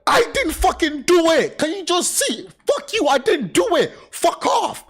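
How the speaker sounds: shrieking fuck-off at the police; angry yell. Pretend. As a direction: yell it like you're being framed. Imagined yelling at accusers.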